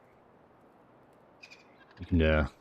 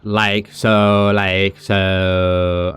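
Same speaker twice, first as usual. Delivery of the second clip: "Like so" louder, drawn-out American lilt; mock American female; 4 dB louder, much slower than usual. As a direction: imitate an American woman, lightly. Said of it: "the American, will, will say".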